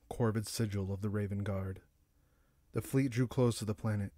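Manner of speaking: ordinary delivery, nothing marked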